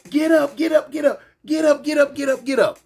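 high, urgent woman yelling; angry yelling. Retold or imitated. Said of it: "she rushed me, grabbing me".

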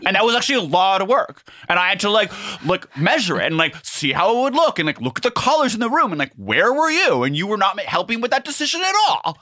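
very high, hurried, indignant complaining; mock aggrieved rant. Stay-at-home partner complains to working girlfriend about lamps, mock aggrieved.

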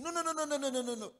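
loud, very high rapid-fire refusal; imitating friend's protest. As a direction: mimic a frantic man refusing, pitch way up. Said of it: "That's the way he talks".

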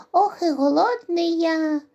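high, loud squeaky whine; hungry mouse. Acted. Mouse at the table with pies, high hungry whine. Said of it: "каже круть".

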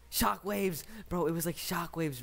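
high, loud triumphant blurt; excited find. Real, not acted. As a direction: pounce on the word you were searching for. Finally finds the word.

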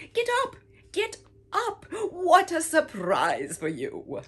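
very high, loud wake-up call; excited mother calling. Acted. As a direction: call out happily to wake the child. Mother wakes Momo excitedly.

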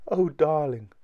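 delighted wife's exclamation; crying out joyfully. A narrator's reading. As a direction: cry out to a beloved. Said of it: "she cried".

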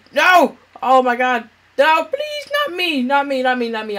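high frantic begging; panicked pleading. Real, not acted. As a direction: plead for your life. Begging not to die.

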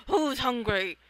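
ordinary talk

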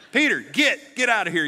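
loud Southern mock Jesus with an Alabama accent. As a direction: shoo him off in an Alabama twang. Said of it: "gonna get a dude from Alabama".

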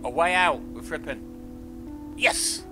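ordinary delivery

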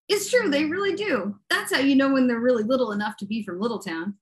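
ordinary talk

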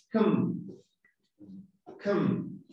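COM as a weak, neutral "kum"; vocal reducida. Shown as a demonstration. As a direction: reduce the vowel in COM to a schwa. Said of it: "No es com". (COM con vocal neutra, sin O)

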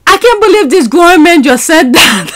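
can't believe what she heard; stunned, half-laughing; laughing disbelief. Real, not acted.

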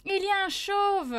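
high, loud amused outburst; startled exclamation. Real, not acted. Streamer discovering a band photo, high astonished outburst. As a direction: blurt it out high and loud in surprise.

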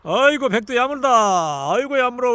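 ordinary talk

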